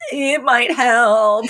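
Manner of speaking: high, very drawn-out comic answer; mock coy reply. Voicing bride's coy reply.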